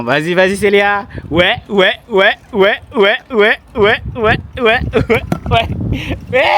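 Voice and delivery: loud rising encouragement, building up; excited cheering. Real, not acted. Cheering friend on bike uphill. (encouragements enthousiastes)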